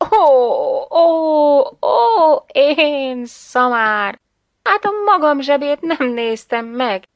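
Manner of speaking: loud, high, long-drawn wailed ohs; exclaiming self-reproach. A narrator's reading. Mother realising she forgot her own pocket, loud self-reproach. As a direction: wail at your own stupidity, stretching the words.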